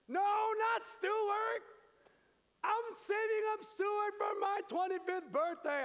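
high, loud, slow-starting protest; indignant older son. Acted. Older son yelling at the servant over his calf, loud and high. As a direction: shout it like a wronged brother.

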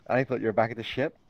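ordinary talk, nothing marked

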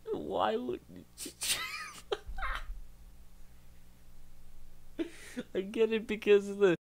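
high, squeaky laughing voice; helpless laughter. Real, not acted. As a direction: try to talk through a real laughing fit. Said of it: "I'm still laughing about that".

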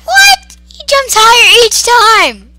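very high, loud incredulous squeal; alarmed outcry, voice shooting up; shrieked disbelief. Real, not acted. Shocked at monster's jumping.

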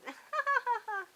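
high, loud hooting cackle; shrieking witch laughter. A narrator's reading. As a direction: shriek with laughter till near tears. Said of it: "The witches shrieked and hooted".

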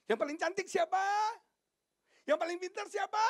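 high, loud rallying question; calling out. Real, not acted. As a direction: shout it out to the whole hall. (berseru lantang dengan nada tinggi)

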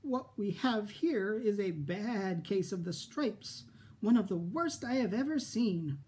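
put-on a sweet old lady, knowing and grave; kindly old woman. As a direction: pronounce it like a wise old doctor.

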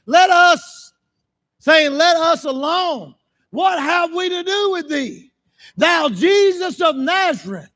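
high, slow shouted scripture; full-force preaching. Acted. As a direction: cry out the demons' plea from the pulpit.

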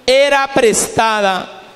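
preacher's acted outcry; retold cry. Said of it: "gritó diciendo".

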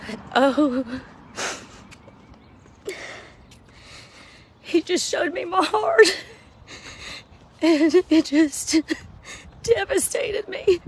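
real crying, pitch cracking up; tearful, crying. Real, not acted. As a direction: voice breaking high into tears. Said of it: "I don't wanna cry".

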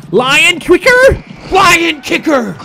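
high, drawn-out excited call; slow, excited high shout; excited yell. Real, not acted. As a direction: yell it up high, stretching the words. Yelling at the game play.

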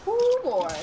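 excited. Real, not acted. Reacts to team wipe.

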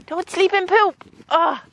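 high playful telling-off; high teasing scold in pet voice; mock scolding. Real, not acted. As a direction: squeal a scold at the pony.

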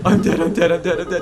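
loud panicked yelling, dying; panicked shout. Real, not acted. Gamer yelling at his own drowning. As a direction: yell in panic as you drown.

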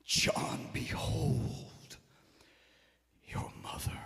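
hushed, whispery words from the cross; Jesus to John. Acted. Preacher voices Jesus entrusting his mother to John, hushed. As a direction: near whisper, with long pauses. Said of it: "He said".

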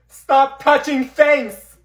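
high, loud and slow yell; slow furious shout to stop the touching. Acted. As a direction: drag out the scream at them. Girl protects her spray can.

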